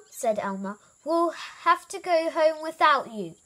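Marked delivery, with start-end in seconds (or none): 1.1-3.3 high, loud call; calling out a warning. A narrator's reading. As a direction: warn someone out of sight. Elmer calls up a warning.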